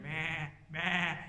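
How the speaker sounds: imitated sheep bleat. Sheep heard behind Saul's claim, mock bleating. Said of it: "all the sheep going".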